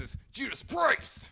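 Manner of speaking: naming the winning band in an announcer voice; announcer call. Shown as a demonstration. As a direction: stretch it out like a rock MC. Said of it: "there's the winner".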